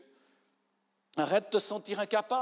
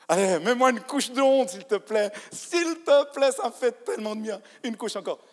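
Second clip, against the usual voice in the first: higher and louder, ironic begging; mock pleading; 6 semitones higher, 7 dB louder than usual. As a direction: ham up begging for a layer of shame. Mock begging for more shame. (supplication ironique, plus aiguë et plus forte)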